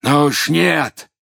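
loud, drawn-out slow yell; shouting. A narrator's reading. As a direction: shout it out slowly in fury. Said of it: "крикнул".

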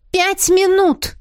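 high, loud, slow snapped reply; snapping back. A narrator's reading. Tisara snapping back at Artega, demanding five minutes. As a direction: bite back at him impatiently. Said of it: "огрызнулась Тисара".

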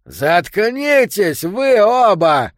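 loud, slow furious shout. A narrator's reading. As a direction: bark at them to shut up. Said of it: "крикнул Йо".

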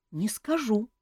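put-on high boyish voice; boy promising. A narrator's reading. Young prince promising to keep a secret, earnest. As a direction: promise it in a young boy's pitch. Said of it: "пообещал Мафей".

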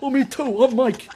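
high, whiny mimicking voice; mocking mimic. Teasing his buddy by mimicking him in a high voice. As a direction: parrot him like a copycat kid.

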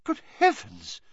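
high-pitched, astonished outburst; shocked exclamation. A narrator's reading. McGonagall staring down at the man, shocked. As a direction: exclaim it in pure disbelief. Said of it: "stopping dead and staring down".

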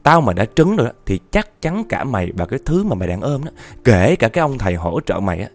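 ordinary delivery, nothing marked

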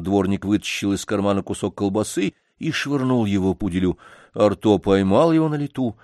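ordinary delivery

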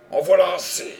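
loud, high cry of outrage; indignant shout. A narrator's reading. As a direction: burst in with indignation. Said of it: "s'écria John Jervis avec indignation". (cri indigné, fort et aigu)

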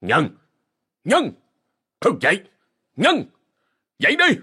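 rising shouts to wake him; calling out. A narrator's reading. Father slapping his son's face, shouting his name. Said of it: "gọi".

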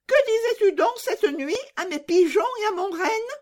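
put-on very high squeaky child interrogation; nosy robber girl. Robber girl questioning Gerda next morning, very high and demanding. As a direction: question sharply in a little bandit's voice.

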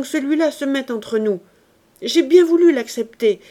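a cowering husband crying out from behind the fountain; frightened shouting. A narrator's reading. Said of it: "en criant".

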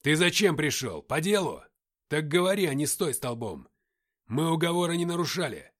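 loud, forceful challenge; angry confrontation. A narrator's reading. Игнат после рявка наседает на Аркаима. (громко и сердито, с напором)

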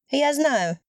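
a short, sharp admission. A narrator's reading. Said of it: "резко произнесла она".

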